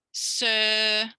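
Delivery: "Sir" held long on a level note; teacher's sustained "Sir"; model syllable. Shown as a demonstration. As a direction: draw out "Sir" clearly for copying. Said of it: "I'm gonna say it and you imitate me".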